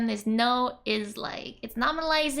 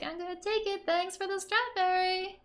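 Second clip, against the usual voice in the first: sweetly sneaking the strawberry; cheeky thief; 8 semitones higher than usual. Retold or imitated. Voicing the sister, high and sly as she steals the strawberry. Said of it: "So she's like".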